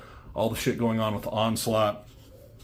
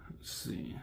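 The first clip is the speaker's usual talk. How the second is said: real whisper to self; whispered aside; 17 dB quieter than usual. Real, not acted. Checking the comic's next panel, in a real whisper to self. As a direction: breathe it out, thinking aloud.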